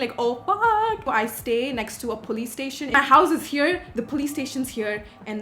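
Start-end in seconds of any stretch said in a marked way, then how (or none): 0.1-1.1 mock panic. Panicking about driving to university.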